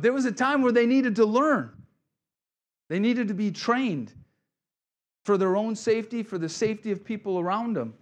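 loud and high, hammering the point home; preaching shout. Real, not acted. Preacher pressing the congregation on training, loud and high. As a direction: rise up and preach it full force.

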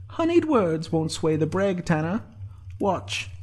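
high, slow musing; high-pitched private thought, drawn out; Sinead's inner voice. A narrator's reading.

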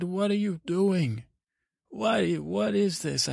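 high-pitched, bewildered demand for an explanation; baffled questioning. Acted. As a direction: act out a parent baffled by their child. Parents question his odd behaviour.

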